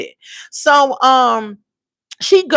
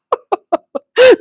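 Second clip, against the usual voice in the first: high burst of laughter; 4 dB louder than usual. Real, not acted. Laughing at the dad.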